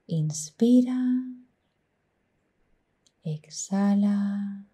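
slow, drawn-out soft breathing cues; guided meditation. Acted. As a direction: stretch each cue gently, trailing into near-whisper. Leads guided breathing exercise.

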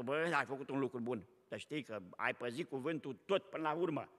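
low, soft sarcastic aside; quiet irony; ironic mock praise. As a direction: say it dry and knowing.

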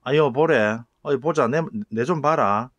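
loud, friendly Gyeongsang calling out; 경상도 사투리로 크게 부르기; Gyeongsang dialect call. Retold or imitated. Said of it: "우리말의 사투리에 이런 말이 있습니다".

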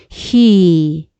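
he with the ee held long; drawn-out vowel. Shown as a demonstration. Teacher reads from phonetic symbols, he drawn out slowly. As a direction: sound out he slowly, stretching its vowel. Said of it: "발음 기호상에 보니까 이".

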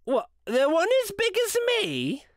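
put-on very high-pitched street boy's voice; cheeky boy. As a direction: pipe it up high like an incredulous lad. Boy answers Scrooge from street.

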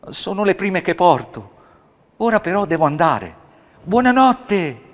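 put-on a small boy calling out his goodbye; high, loud child voice; happy child.

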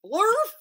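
high, loud nonsense cry; shouted blurf. Acted. Teacher reading the silly book to kids, loud squeaky blurf.

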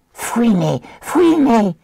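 créature qui siffle, très aigu; Gollum. A narrator's reading. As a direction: siffler d'une voix de créature haut perchée. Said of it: "siffla-t-il". (sifflement aigu de Gollum)

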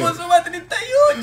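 high, loud mocking parrot voice; mocking imitation. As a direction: repeat his line in a high mocking squeal. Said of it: "A ver, ¿cómo era?".

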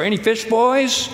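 high call carried across the water; cheerful shout to the fishermen; calling out from shore. Retold or imitated. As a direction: call out to the boat. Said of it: "he yells from the shore".

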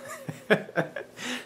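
a silent, airy burst of laughter; breathy laugh. Real, not acted. Jack at the pharmacy counter after his crack joke, airy laugh.

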